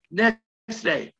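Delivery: ordinary talk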